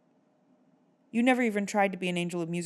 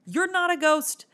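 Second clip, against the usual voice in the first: higher, louder exasperated rant; exasperated shout; 10 semitones higher, 6 dB louder than usual. Real, not acted. Vlogger yells at the movie Phantom, higher and louder. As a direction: shout him down.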